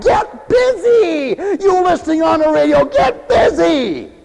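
loud, high, drawn-out shout; fiery command. Real, not acted. Preacher shouting at radio listeners to get busy. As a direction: hammer the command at the congregation.

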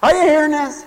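ordinary talk

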